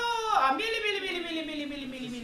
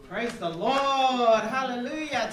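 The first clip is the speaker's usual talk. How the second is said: slow, drawn-out praise; preacher praise; 4 semitones lower, 5 dB louder, slower than usual. Acted. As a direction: proclaim it like a preacher. Preacher-style exclamation.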